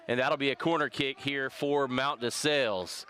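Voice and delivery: ordinary talk, nothing marked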